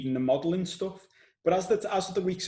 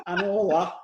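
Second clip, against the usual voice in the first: hammed-up Scouse, louder and higher; jokey, raised-voice Scouse impersonation; Scouse accent; 5 semitones higher, 7 dB louder than usual. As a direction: play up a Scouse accent for laughs. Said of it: "Didn't do it in a Scouse accent".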